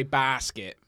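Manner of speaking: "basket" with a long open ah vowel; "basket" stretched as "bahsket". Shown as a demonstration. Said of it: "I elongate my as".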